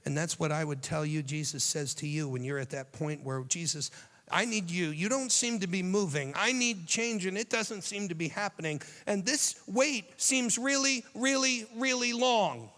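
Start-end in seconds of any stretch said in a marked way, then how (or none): 3.4-8.8 pleading voice, pitch rising; desperate prayer. Acted. A weary believer begging Jesus, pleading. 9.1-12.7 slow, high, stretched really really long; drawn-out complaint. Acted. A weary believer to Jesus, slow and stretched. Voicing a desperate prayer.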